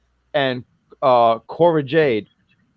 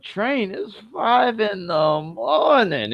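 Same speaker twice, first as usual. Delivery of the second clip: higher, softer and slow, just woken up; groggy woken friend; 6 semitones higher, 3 dB quieter, much slower than usual. Retold or imitated. Cora woken at five by Raquel, higher, softer and slow. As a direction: drag it out like you were dragged out of bed.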